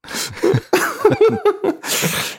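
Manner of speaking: high squealing burst of laughter; shrieking laugh. Real, not acted. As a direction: let a shrill laugh burst out. Big laugh.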